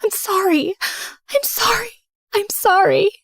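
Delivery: high-pitched, crying plea; tearful apology. A narrator's reading. Rose begs Jessica's forgiveness, crying hard. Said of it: "I was crying hard".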